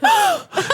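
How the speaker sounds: sharp high gasp; mock upset gasp. Driver caught by camera flash, mock startled gasp. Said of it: "I'm so upset. I'm probably like".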